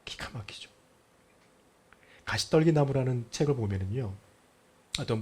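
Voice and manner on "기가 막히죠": amazed whisper; hushed marvel at God's care. Real, not acted. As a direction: breathe it in awe. Marvelling aside.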